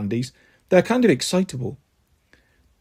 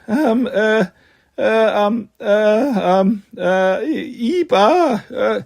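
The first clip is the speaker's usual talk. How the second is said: halting, higher-pitched ums and uhs; stuttering; 4 semitones higher, much slower than usual. A narrator's reading. Andy stumped by the guard's test, stuttering. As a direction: flounder for an answer in broken sounds. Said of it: "I stutter".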